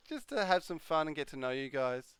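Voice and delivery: usual speaking voice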